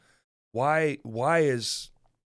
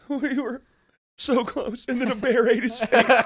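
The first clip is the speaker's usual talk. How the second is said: high, laughing mock-tragic tale; 11 semitones higher, 7 dB louder than usual. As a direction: laugh through a hammed-up sad story. Joking romantic tragedy story.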